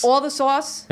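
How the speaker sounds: mock Long Island drawl on sauce; Long Island accent. Joking about a friend's Long Island accent. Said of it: "Long Island accent".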